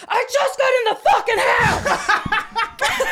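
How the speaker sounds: loud, slow, high yell broken by laughter; angry shout. Acted. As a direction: yell it like the furious dad. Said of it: "and he said".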